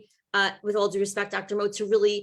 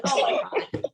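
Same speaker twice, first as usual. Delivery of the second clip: much higher, whispered mock gasp; stunned gasp; 11 semitones higher than usual. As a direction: breathe it out in disbelief. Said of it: "I was like".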